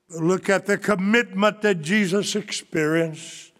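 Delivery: ordinary talk, nothing marked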